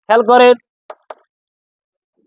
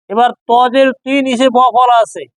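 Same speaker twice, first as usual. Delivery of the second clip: ordinary talk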